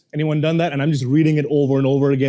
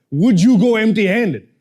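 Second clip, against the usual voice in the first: the question repeated louder with emphasis on you; louder mocking echo; 5 semitones higher, 5 dB louder than usual. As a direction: throw his question back, louder.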